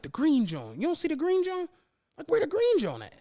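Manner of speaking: normal talking voice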